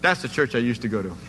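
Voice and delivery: put-on castaway sheepishly pointing to his old church; sheepish punchline. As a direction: drop it dryly, a little embarrassed. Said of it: "He goes".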